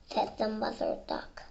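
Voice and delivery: normal talking voice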